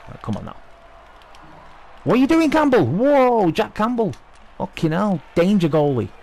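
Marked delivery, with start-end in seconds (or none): 2.0-6.1 very high, loud yelling at the goalie; furious shout. Real, not acted. Gamer yelling at his own goalie after a mistake, loud and very high. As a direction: scream at the goalie in disbelief.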